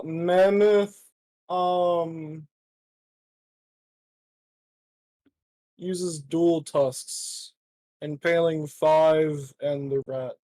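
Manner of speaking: drawn-out game master telling; slow dramatic narration. Acted. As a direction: stretch each word, building the threat. Game master narrating monster attack.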